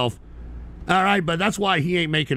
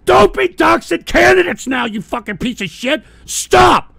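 high, loud screaming at the troll; real rage; furious shout; 10 semitones higher, 8 dB louder than usual. Real, not acted. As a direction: shout him down. Streamer yelling at doxing TTS.

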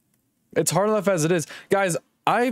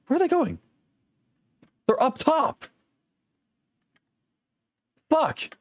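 higher, urgent callouts; tense gaming; 10 semitones higher than usual. Real, not acted. Streamer tracking enemies mid fight, urgent. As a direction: call them out fast, on edge.

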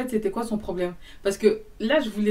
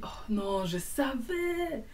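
high, drawn-out wail, kept soft; dramatic lament; 4 semitones higher, 5 dB quieter, much slower than usual. Retold or imitated. Man says he knew it, imitated high drawn-out lament. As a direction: stretch it out in soft mock despair. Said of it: "il parlait comme si".

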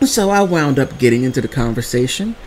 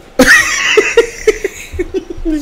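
laugh. Real, not acted. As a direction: laugh about the silly sister. Laughs about her sister.

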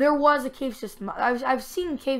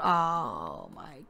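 ordinary talk, nothing marked

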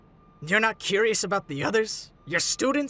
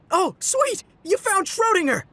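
high, excited exclamation; delighted; 9 semitones higher, 4 dB louder than usual. Acted. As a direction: burst out happily at the find. Delighted to see the cat.

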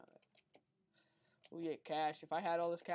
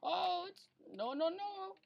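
much higher and louder no no no; alarmed; 13 semitones higher, 4 dB louder, much slower than usual. Real, not acted. Gamer spotting lava in the level, alarmed high pleading. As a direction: panic as the lava appears.

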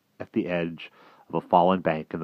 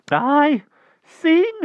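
very high eager cry; hedgehog voice; 10 dB louder than usual. A narrator's reading. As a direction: cry it out hurriedly. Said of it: "cried the hedgehog".

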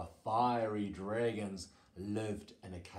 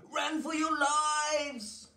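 high, loud, drawn-out panic cry; high-pitched slow shout of alarm; storybook scream; 5 dB louder than usual. A narrator's reading. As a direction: scream a warning, stretched out and high. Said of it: "they screamed".

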